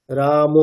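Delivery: রাম with a final o sound, "ramo"; Bengali-style ending. Shown as a demonstration. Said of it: "সে রামও যখন শুনবে সে তার স্পেলিংটা". (বাংলা ঢঙে শেষে ও-কার)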